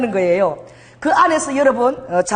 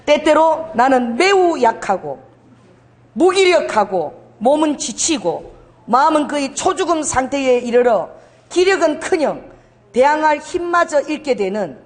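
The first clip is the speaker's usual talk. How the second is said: slow, raised reading of the bulletin text; slow reading aloud; 4 semitones higher, slower than usual. Shown as a demonstration. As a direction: read it out slowly and deliberately. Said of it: "자, 한번 읽겠습니다".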